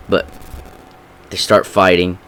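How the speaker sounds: usual speaking voice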